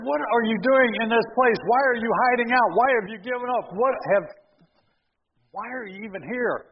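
God confronting Elijah, loud and high; God's challenge. Acted. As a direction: demand an answer from him.

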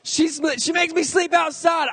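kid's fake cry for help. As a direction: wail it like a bratty six-year-old. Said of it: "I start threatening my mom".

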